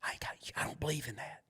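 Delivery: quick breathless whisper, no voice; imitating a teacher whispering under her breath. Teacher whispering her agreement to the dad, hushed. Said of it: "Why you gotta whisper that?".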